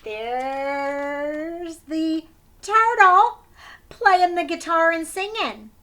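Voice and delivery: ta-da announcement; excited reveal. A narrator's reading. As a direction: unveil him with delight. Revealing turtle to kids.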